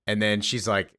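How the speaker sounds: ordinary talk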